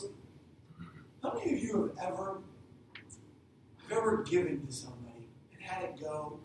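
ordinary talk

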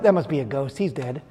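low, soft mock spooky; quiet. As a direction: drop to a hushed ghost-story tone. Disciples insist Peter is dead.

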